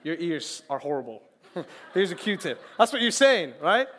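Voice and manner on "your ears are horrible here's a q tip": softer, offhand mock giver; understated mock insult; deadpan gift giver. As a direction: hand over Q-tips with a straight face. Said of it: "what if I gave you Q-tips".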